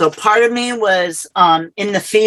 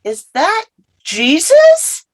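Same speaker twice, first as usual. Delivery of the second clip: awed wonder; 13 semitones higher than usual. Retold or imitated. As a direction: ask it as if you can hardly believe your eyes. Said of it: "And I went".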